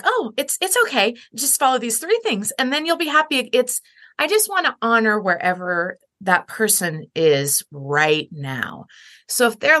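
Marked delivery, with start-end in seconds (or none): 0.0-3.5 high, breezy, dismissive comfort; lightly mock a pep talk pitched well up; mock cheery platitude. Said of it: "don't wanna be like".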